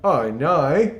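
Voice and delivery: drawn-out Australian Oh no, hammed up; Australian accent. Shown as a demonstration. Visitor shows his Australian Oh no, mock Aussie accent. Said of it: "I go like this".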